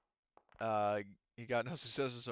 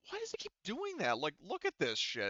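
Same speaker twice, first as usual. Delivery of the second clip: high-pitched, incredulous complaint; exasperated griping; 9 semitones higher than usual. Real, not acted. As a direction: whine it up high in disbelief. GM griping at glitching dice or figure.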